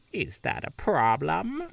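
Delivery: a hint of the Wicked Witch. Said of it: "make her sound like the Wicked Witch of the West".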